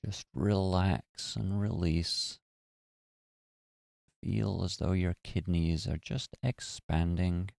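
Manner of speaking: calm, even meditation voice with long pauses; guided meditation. Acted. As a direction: guide listeners gently through relaxing the body. Leads a guided body-relaxation meditation.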